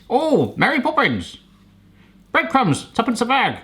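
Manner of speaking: high, hammed-up old English accent; mock old English. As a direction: put on a posh Mary Poppins English accent. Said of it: "Just like good old English".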